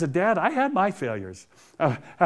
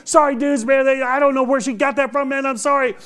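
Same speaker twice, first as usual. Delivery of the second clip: flustered apology; 10 semitones higher, 6 dB louder than usual. Retold or imitated. Apologizing to the teenagers.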